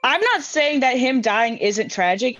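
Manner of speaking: normal talking voice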